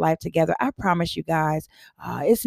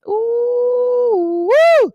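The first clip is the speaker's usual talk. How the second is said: loud, high excited cheer; excited whoop; 6 dB louder than usual. Real, not acted. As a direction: whoop it up with joy. Hyped cheer before affirmation time.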